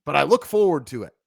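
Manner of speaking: Twitter voice. Retold or imitated. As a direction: read it like a gushing tweet. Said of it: "Bobby's Twitter voice".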